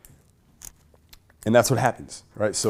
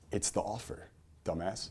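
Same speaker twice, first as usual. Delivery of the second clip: a softer, blunt mock insult; Dan Kennedy's phrasing; 2 semitones lower, 11 dB quieter than usual. Imitating Dan Kennedy's put-down to the audience, soft and deadpan. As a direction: drop the insult quietly, deadpan. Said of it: "that's how he would say it".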